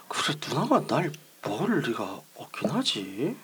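puzzled whisper to himself. Acted. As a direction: whisper it, baffled. Brother muttering, puzzled and alone.

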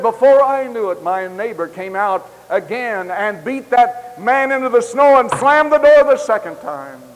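loud, high, rising preacher's cry; preaching at full force. Acted. Preacher building the story to the congregation at full force. Sermon climax retelling the beating.

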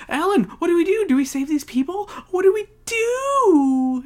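high, loud mock hysteria; hammed-up frantic cry for help; panicked plea. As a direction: wail it, stretching the final word. Acted panicked plea to Alan.

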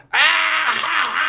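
high, loud joyful yell; jovial yell. Retold or imitated. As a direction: let out a big happy shout. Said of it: "we hear him go".

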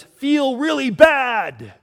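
high, loud and slow, a hammed-up ranting preacher; mock street preacher shouting guilt at passers-by; mock angry street preacher. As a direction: bellow it like a nutty street-corner preacher. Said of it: "angry street preacher".

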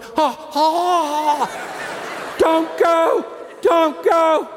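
mock-beg the departing guests not to leave them with the cleanup; mock desperate plea. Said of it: "I'm going".

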